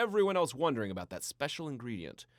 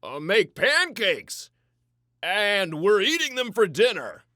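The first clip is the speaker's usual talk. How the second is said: higher, much louder, playful announcing; twinkle in his eye; 6 semitones higher, 12 dB louder than usual. A narrator's reading. Papa promising pancakes for dinner, with a playful twinkle. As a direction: sell it like a treat. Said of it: "with a twinkle in his eye".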